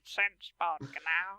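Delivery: monster voice imitation. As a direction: drag out the monster name slowly. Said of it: "I liked your Sinspawn Gnau".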